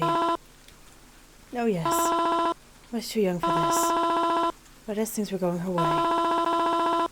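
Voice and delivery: high, slow mourning mother; a frail mother lingering on every word; high drawn-out grieving mom. Acted. Mother character speaks.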